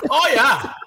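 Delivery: high, laughing Minnesota-like "Oh, yeah"; Tony's voice. Retold or imitated. Copying Tony's catchphrase, laughing, in a Minnesota-like accent. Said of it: "saying it in his voice".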